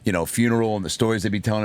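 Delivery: usual speaking voice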